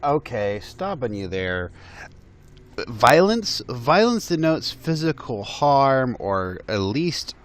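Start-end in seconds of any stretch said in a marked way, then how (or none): none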